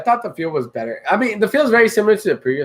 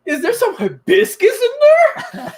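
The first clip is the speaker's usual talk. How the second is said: snooty taster; slower than usual. Retold or imitated. Mocking elitist beer tasters.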